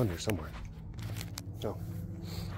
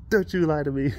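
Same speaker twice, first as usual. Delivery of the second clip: higher, sharper accusation; accusing wife; 6 semitones higher than usual. Retold or imitated. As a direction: call out his lie. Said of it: "And she's like".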